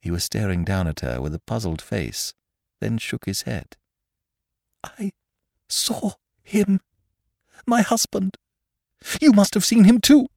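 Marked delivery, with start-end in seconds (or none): 4.8-10.3 high, halting voice building to a loud plea; frightened woman, pitch held high; terrified insistence. Acted. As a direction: start faint and broken, end insistent. Terrified woman insists she saw husband.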